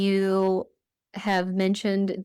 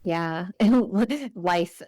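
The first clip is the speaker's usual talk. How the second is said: ordinary talk, nothing marked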